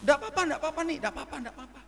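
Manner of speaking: suara tinggi menirukan manajer; buru-buru menepis rasa bersalah tamu; manajer menenangkan. Retold or imitated. Manajer menepis permintaan maaf. (tiruan manajer yang menenangkan dengan nada tinggi)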